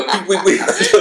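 be a sulky camel refusing everything; grumpy camel. Retold or imitated. Camel refuses the tourists, hammed-up high and laughing.

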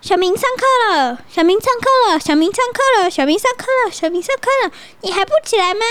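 high, repeated calling to wake him; calling friend. Acted. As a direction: keep calling a sleeping friend. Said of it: "他朋友就说". (朋友叫醒小明，一遍遍地喊)

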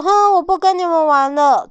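high, loud sulky huff; huffy wolf quits. Acted. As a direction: storm off in a huff. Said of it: "哈狼好凶哦".

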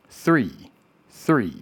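"three" with dental th gliding into r, said twice separately; careful th plus r in "three"; correct TH-R. Shown as a demonstration. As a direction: demonstrate "three" with tongue between the teeth, then r. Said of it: "正确的听".

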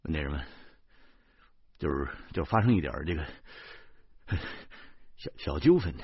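low, sheepish hemming and hawing; apologetic, halting, low-voiced understatement; embarrassed. A narrator's reading. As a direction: stammer an awkward explanation to an officer. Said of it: "不好意思地说".

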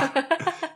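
high-pitched laugh; laughter. Real, not acted. Couple teasing about bedroom frequency, high laughing. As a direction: break up in high giggles.

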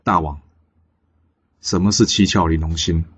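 low, soft, angry challenge; contained anger. A narrator's reading. Bigan confronts the king about the heart he wants, quietly angry. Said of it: "比干非常生气地看着纣王说".

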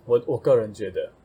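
ordinary delivery

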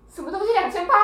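imitating mom's shocked high question; mock outrage at the price tag. Mimicking mom staring at the checkout screen, shocked.

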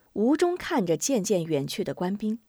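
ordinary delivery

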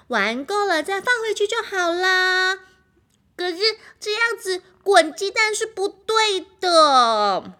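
put-on childish argument. Characters argue about eggs.

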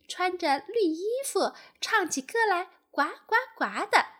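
high-pitched little tadpole question; tadpoles asking politely. A narrator's reading. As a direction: ask eagerly in a baby tadpole's high voice. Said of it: "他们轻声地问". (小蝌蚪高声询问)

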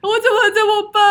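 retold crying at the service desk, high and teary; imitating crying. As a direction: sob out the words, half laughing. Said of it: "在服务台面前大哭".

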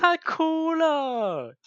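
drawn-out, very high alarmed cry; panicked coworker. Retold or imitated. Coworkers panicking as she bursts into tears, high, slow mock wail.

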